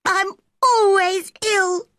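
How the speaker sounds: put-on high, whining child; tearful self-pity. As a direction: wail it piteously, very high. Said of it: "started to cry".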